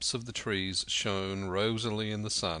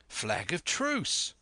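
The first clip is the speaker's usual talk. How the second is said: high, drawn-out call; raised-pitch lookout's cry, each word stretched; 6 semitones higher, slower than usual. A narrator's reading. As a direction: call it out slowly as news from a lookout. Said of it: "I heard someone say".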